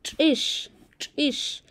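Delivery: "Chish" with a clipped, popped ch and a hissed end; accented ch. Shown as a demonstration. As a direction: snap the ch in "chish" and hiss the sh. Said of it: "T y la X con acento ch".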